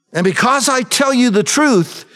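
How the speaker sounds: preached scripture, rising in force; scripture reading. Acted. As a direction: proclaim the verses like a preacher.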